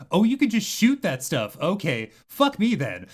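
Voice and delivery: sudden high-pitched excited realization. Retold or imitated. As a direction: blurt it out, thrilled and exasperated. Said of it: "I was like".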